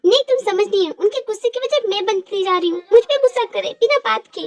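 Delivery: put-on girl upset, asking what she did wrong; young woman's voice. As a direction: play the girl pleading to know her mistake. Male actor voices young woman.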